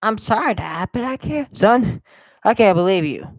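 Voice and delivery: lightly voiced high pleading son. Gamer voicing son to dad, high pleading.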